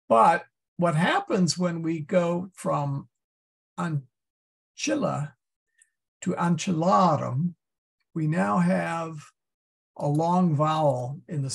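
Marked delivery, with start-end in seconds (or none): 3.8-5.3 slow "ancilla", AN set apart and stressed, short i; stress on first syllable. Shown as a demonstration. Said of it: "you put the third syllable from the end". 6.3-7.5 "ancillarum" with a long, stressed LA; stress on second-to-last syllable. Shown as a demonstration. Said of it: "So the stress goes on that second vowel".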